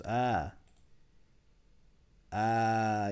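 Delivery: usual speaking voice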